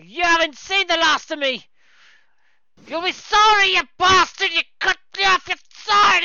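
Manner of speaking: put-on high, loud drunken threats; drunken angry shouts. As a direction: yell back while stumbling out. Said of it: "Stumbling his way out".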